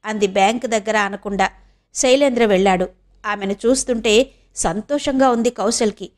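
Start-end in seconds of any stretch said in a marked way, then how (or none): none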